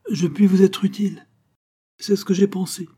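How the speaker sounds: high, loud, pleading cry; frightened plea. A narrator's reading. Venture at gunpoint begs Rocambole, high panicked cry. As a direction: beg for his life in panic.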